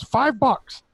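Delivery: very high squawked five bucks; parrot voice. Retold or imitated. Retelling the parrot's line, very high squawk. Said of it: "and he goes".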